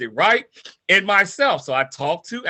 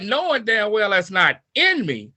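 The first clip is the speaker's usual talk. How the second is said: ordinary talk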